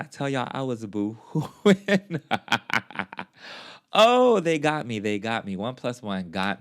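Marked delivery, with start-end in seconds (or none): 0.0-2.3 laughing. Real, not acted. 3.9-5.0 "they got me" louder and higher, overcome; emphatic. Real, not acted. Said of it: "emphatic first take".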